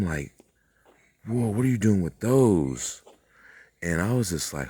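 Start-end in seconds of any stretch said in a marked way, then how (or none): 1.3-3.0 high, loud and slow startled question; stunned disbelief at what she holds; surprised whoa. Retold or imitated. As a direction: gasp it out in awe. Startled at advanced beings' binoculars.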